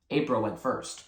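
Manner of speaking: plain reading voice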